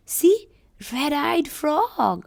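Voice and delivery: put-on teacher thrilling children about the red-eyed frog; excited discovery. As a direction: point it out with childlike wonder.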